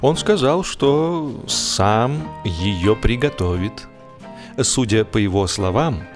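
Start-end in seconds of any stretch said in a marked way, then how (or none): none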